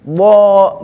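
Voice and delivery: usual speaking voice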